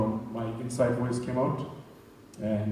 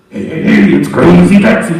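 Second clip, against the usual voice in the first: loud, high arcade-game announcer shout; Crazy Taxi announcer; 5 semitones higher, 19 dB louder than usual. Retold or imitated. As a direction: blast it like a game intro. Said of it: "sounds like the guy from Crazy Taxi".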